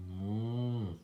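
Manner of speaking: long drawn-out moo; storybook cow mooing. A narrator's reading. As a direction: imitate a cow, holding the sound. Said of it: "Cow said".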